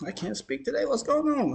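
high, loud exasperated self-check; real frustration at tripping over his own words; frustrated at stumbling. Real, not acted. Fumbling his words on camera, exasperated. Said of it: "I'm tired, dehydrated".

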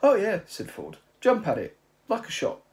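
high, loud eager agreement; eager Ford. Acted. Ford eagerly agreeing with Zaphod, high and loud. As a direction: jump in keenly.

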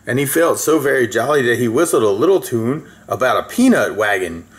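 ordinary delivery, nothing marked

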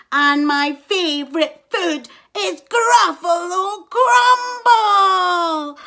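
menacing mouse. A narrator's reading. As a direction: lick your lips at the Gruffalo. Mouse threatens Gruffalo.

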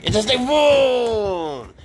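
long high slow vroom noise; drawn-out vroom. Real, not acted. Owner voicing the toy snake for the cat, long high vroom. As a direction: make the toy zoom by.

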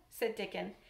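ordinary delivery

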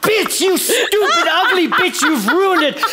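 screaming at her for ruining it; furious outburst. Acted. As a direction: lash out in rage. Outburst at failed rehearsal.

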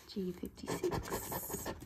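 slow breathy whisper, barely audible. Real, not acted. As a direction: whisper the number slowly to yourself. Repeats the number under breath.

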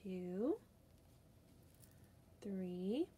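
ordinary delivery

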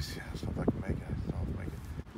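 fast, whispered, spent gasp; exhausted whisper. Retold or imitated. Uncle retold near collapse on the trail, imitated whispered exhaustion. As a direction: mimic a man with nothing left.

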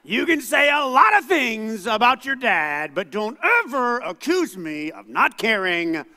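loud, high shout with a laugh in it; hammed-up dad shouting through laughter; angry parental speech. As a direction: drive the point home to the kid. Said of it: "That's the speech".

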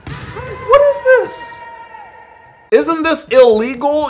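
shocked shout. Real, not acted. Reacting to the volleyball clip, slow high shocked shout. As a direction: stretch it out in shock.

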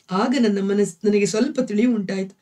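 usual speaking voice

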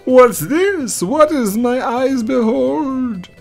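prophesy doom in a high, dramatic voice; fortune teller voice. Acted.

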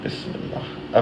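ordinary delivery

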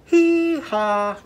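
high, loud whooping hee-haw; a cowboy's hee-haw holler. Shown as a demonstration. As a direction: whoop it out for the kids. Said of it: "it's gonna go like this".

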